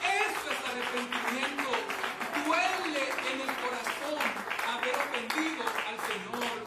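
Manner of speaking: loud, emphatic pulpit declaration; full-force preaching climax. Acted. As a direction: drive it home to the congregation.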